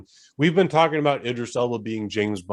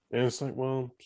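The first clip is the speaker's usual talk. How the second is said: ordinary talk, nothing marked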